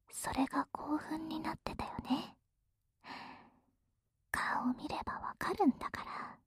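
whispering. Acted. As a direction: whisper it gently, up close. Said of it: "ささやいてあげる".

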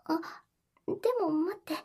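ordinary talk, nothing marked

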